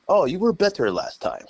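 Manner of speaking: high mock fan voice; blunt European fan. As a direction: imitate a bluntly honest fan. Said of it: "they'll just be like".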